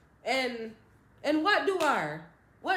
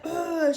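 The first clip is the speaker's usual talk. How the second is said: retching groan; 6 semitones higher, 7 dB louder than usual. Retold or imitated. Throwing up.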